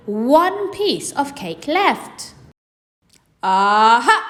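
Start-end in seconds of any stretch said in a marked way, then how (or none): 3.4-4.2 loud gotcha exclamation; catching her out; triumphant aha. Acted. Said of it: "said Mister Large".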